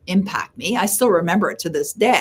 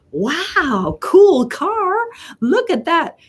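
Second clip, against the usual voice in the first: much higher, gushing and delighted; nice, impressed; 11 semitones higher, much slower than usual. Retold or imitated. Mom admiring daughter's new convertible, impressed and much higher. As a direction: coo over the new car like a thrilled mom. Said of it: "in a nice way".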